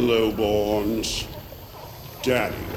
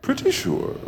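mocking echo. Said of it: "I sense a lack of conviction".

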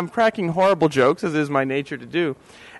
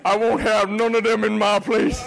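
mock bigot; 3 semitones higher than usual. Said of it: "Paul Fisher's like".

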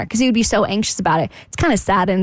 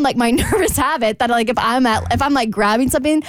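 ordinary delivery

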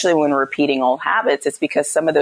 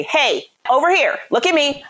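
higher, attention-catching call; raised voice, not yelling; 9 semitones higher than usual. Shown as a demonstration. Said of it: "I raise my voice".

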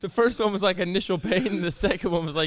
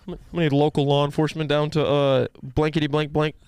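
police dispatcher voice; 3 semitones lower, 6 dB louder than usual. Pretend. Mock police radio call.

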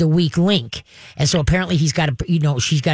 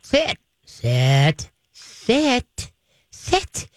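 repeated pleading sit; 5 semitones higher, much slower than usual. Retold or imitated. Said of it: "they'll go".

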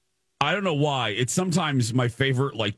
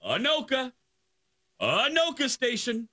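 high, held train announcer call; loudspeaker announcement; 13 semitones higher, 3 dB quieter than usual. Retold or imitated. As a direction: imitate a station announcement over the speaker. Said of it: "I hear over the loudspeaker".

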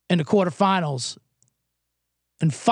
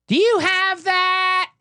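put-on drawn-out ditzy drunk girl voice, higher; high, slow drunk girl; 11 semitones higher, much slower than usual. Mocking drunk girls ordering at the bar, slow and high. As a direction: slur it slowly like a tipsy girl. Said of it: "they're like".

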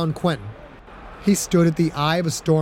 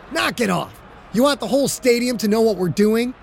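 sharp rebuke; 7 semitones higher than usual. Acted. Quarterback snapping at receivers.

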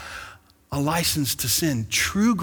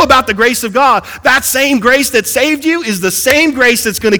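higher, louder excited preaching; preaching at full force; 8 semitones higher, 8 dB louder than usual. Acted. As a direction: fire up the congregation about grace.

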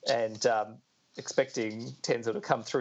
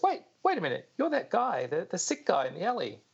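much higher, startled realisation; hammed-up surprise, pitched up; sudden recognition; 8 semitones higher than usual. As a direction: blurt it as it dawns on you. Element recognising a stranger.

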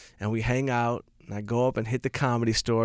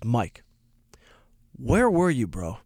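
serious friend; slower than usual. Retold or imitated. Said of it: "he was dead serious".

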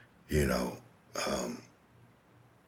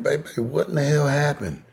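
high, alarmed mock question; a touch of shock, pitched high; imitating himself; 11 semitones higher, 4 dB louder than usual. Nephew asking his aunt about bullet holes, high mock alarm. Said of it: "I'm like".

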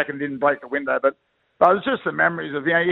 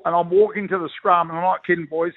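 ordinary delivery

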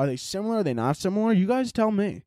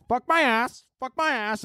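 squawky high parrot impression, much higher; 12 semitones higher than usual. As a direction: squawk it like a talking bird. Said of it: "He's like".

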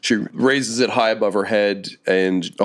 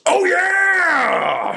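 huge, high drawn-out yell; triumphant crashing-through-the-wall yell; yelled catchphrase. Acted. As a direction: burst in with a roaring, stretched-out holler. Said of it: "and yells".

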